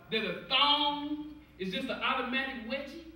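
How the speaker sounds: usual speaking voice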